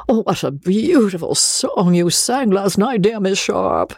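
high, effusive gentleman's compliment; fussy suitor laying on the flattery; gushing gallant Joseph. Acted. Joseph gushes over her song.

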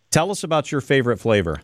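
ordinary talk

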